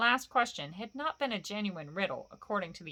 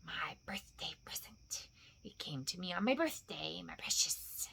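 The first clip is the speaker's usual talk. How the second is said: soft, low creature whisper; hissed, cherishing murmur; whispered self-talk; 3 semitones lower, 9 dB quieter than usual. A narrator's reading. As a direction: whisper possessively to yourself. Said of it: "So he had always said to himself".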